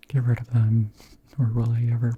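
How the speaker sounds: ordinary delivery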